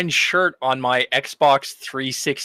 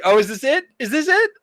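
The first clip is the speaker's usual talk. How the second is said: climbing higher on each is this it; a player sure the character dies here, hammed up; mock anxious disbelief; 13 semitones higher than usual. Player retelling his own game moment, asking in mock disbelief, pitched high.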